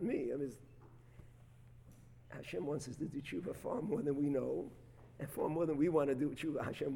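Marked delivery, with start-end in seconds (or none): none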